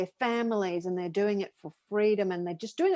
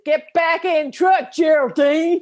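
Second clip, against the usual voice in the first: loud, high Southern holler, drawn out; imitated Southern shout, breaking into laughter; Southern accent; 7 semitones higher, 10 dB louder, slower than usual. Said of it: "they're using a real Southern accent".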